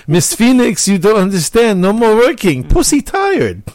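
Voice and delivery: mock Spanish accent, high and hammed-up; bad Spanish accent. Host imitating Luna complaining to Miss Phoenix, bad Spanish accent. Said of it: "a really bad Spanish accent".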